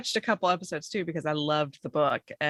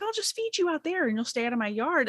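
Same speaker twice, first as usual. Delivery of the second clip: sweet talk to squirrels; 8 semitones higher than usual. Retold or imitated. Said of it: "And I'm like".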